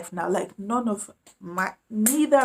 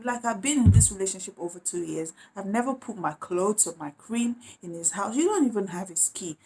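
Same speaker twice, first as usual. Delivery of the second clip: usual speaking voice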